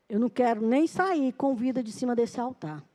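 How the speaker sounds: ordinary talk, nothing marked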